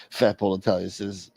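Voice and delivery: usual speaking voice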